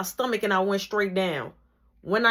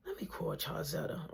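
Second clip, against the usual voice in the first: quiet to herself; 3 semitones lower, 15 dB quieter than usual. Retold or imitated. Sneaky phone plan.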